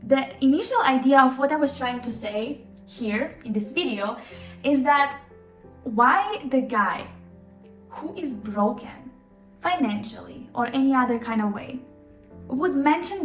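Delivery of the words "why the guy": ordinary talk, nothing marked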